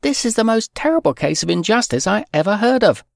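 put-on high pleading girl's voice, fast; upset little girl. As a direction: protest like a desperate child. Fern protesting injustice.

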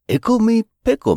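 squeaky high Ickle; high squeal. Acted. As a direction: sing it out high and bright. Playful rhyme, squeaky first take.

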